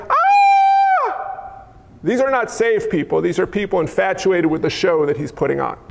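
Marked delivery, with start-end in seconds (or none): 0.1-1.6 high starstruck shriek; screaming crowd. Acted. Crowds seeing the miracle worker come down, high screaming. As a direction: squeal like infatuated fans. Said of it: "the crowds are like".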